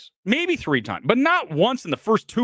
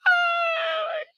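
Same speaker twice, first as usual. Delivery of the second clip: high squealing laugh-cry, gasping; barely able to speak through tears of laughter; hysterical laughter; 4 dB quieter, much slower than usual. Real, not acted. Streamer telling chat he's never laughed harder, hysterical laughing. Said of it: "I'm laughing harder at this than anything".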